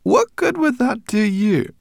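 high, loud, drawn-out question; baffled brownie voice, stretched and high; surprised brownie. A narrator's reading. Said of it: "in surprise".

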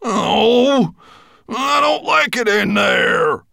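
high, loud and slow whimper; whimpering dwarf. Acted. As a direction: whine it out slowly.

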